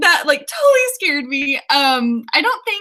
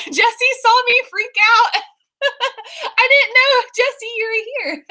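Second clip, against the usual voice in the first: high-pitched, giggly embarrassed surprise; laughing; 9 semitones higher than usual. Real, not acted. Host realizing Jesse saw her freak out, laughing. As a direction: talk through real giggles, pitched up.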